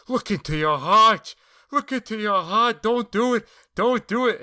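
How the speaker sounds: frantic high-pitched pleading; shrieking panicked plea; begging for his life. Retold or imitated. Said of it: "he starts begging for his life".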